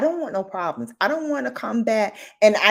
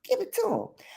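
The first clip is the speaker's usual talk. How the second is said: pitched way up, pushing the point; emphatic urging; 14 semitones higher, 4 dB quieter than usual. Real, not acted. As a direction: hype them up.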